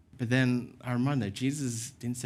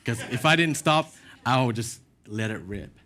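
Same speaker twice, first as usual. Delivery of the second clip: ordinary talk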